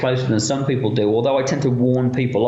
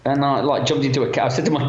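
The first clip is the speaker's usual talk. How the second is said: normal talking voice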